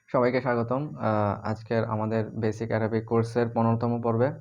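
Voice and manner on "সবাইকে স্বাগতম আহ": usual speaking voice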